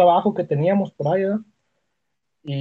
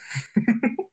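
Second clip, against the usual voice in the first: high embarrassed laugh; 7 semitones higher, 3 dB quieter than usual. Real, not acted. Laughs finishing girlfriend story.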